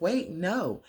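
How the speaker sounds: high-pitched acted objection; mock protest. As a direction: lift the pitch in a mild panic.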